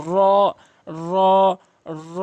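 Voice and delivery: র with rounded mouth, held one count; full, rounded r. Shown as a demonstration. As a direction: curl the tongue for a full, long র. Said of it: "মুখ গোলাকার করে পুর করে".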